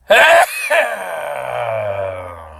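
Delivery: long loud sneeze; real sneeze, loud and drawn out; sneezing. Real, not acted. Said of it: "is try to sneeze".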